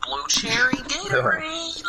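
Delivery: silly voice. Pretend.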